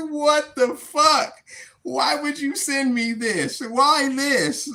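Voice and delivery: slow, drawn-out baffled "what the fuck"; retold disbelief. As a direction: stretch it out in disbelief. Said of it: "I'm like".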